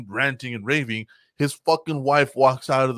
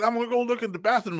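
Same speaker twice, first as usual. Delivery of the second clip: agitated husband; 11 semitones higher, faster than usual. Retold or imitated. Husband announcing search.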